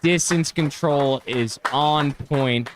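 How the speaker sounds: live play-by-play, building up; golf commentator. Real, not acted. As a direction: call the shot as it lands. Live play-by-play of approach shot.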